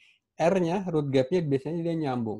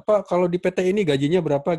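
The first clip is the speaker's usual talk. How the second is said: higher, louder mock student asking; raised-voice imitation of a student's query; imitated student question; 6 semitones higher, 8 dB louder than usual. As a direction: lightly act out an eager question to the teacher. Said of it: "Tapi jangan tanya-tanya".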